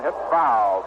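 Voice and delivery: usual speaking voice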